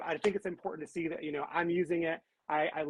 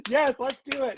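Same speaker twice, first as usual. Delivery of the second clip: laughing excitedly, higher; bright laugh into yes let's do it; 8 semitones higher, 4 dB louder than usual. Real, not acted. Host laughing as co-host announces the raffle.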